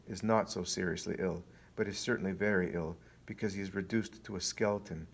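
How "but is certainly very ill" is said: ordinary delivery, nothing marked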